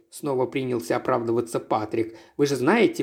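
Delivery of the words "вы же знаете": ordinary delivery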